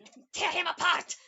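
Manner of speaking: sudden loud, high roar of rage; furious roar. Acted. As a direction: let the rage explode on apart. Salah bursting into rage.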